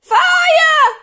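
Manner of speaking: high, piercing alarm yell. A narrator's reading. Old woman burning inside, piercing yell. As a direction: scream the alarm at full volume. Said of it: "the old woman yelled".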